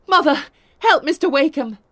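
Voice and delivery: high, loud urgent call. A narrator's reading. Daughter calling to her mother, high and urgent.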